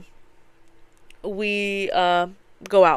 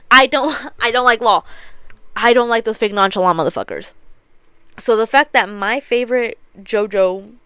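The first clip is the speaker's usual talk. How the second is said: usual speaking voice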